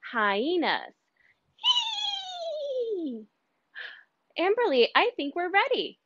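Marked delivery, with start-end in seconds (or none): 1.6-3.2 high cackling hyena giggle; hyena laugh. Shown as a demonstration. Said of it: "your best hyena laugh".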